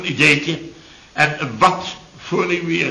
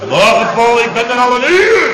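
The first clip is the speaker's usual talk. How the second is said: loud greeting; 8 semitones higher, 5 dB louder than usual. Acted. Chef greets late Paul.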